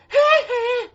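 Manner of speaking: squealing laugh; high laugh. Real, not acted. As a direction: laugh high after singing. Laughs after singing.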